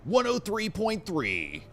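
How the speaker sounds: high, drawn-out radio jingle voice; radio station DJ. Pretend. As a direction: announce the frequency like a hammy DJ. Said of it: "which sounds like a music station".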